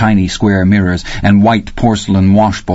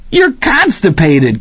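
gleeful chortle; 10 semitones higher, slower than usual. Acted. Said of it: "Kenny chortled gleefully".

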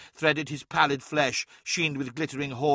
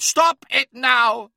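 shouting; 11 semitones higher, 9 dB louder, slower than usual. Acted. Shouted command continues.

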